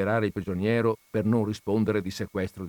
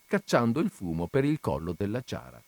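normal talking voice

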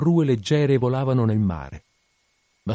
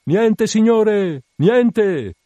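high, loud, drawn-out call; long-drawn lookout's cry; cried out; 12 semitones higher, 7 dB louder, much slower than usual. A narrator's reading. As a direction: call it down from the masthead, stretching the words. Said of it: "fu il grido che calò in risposta".

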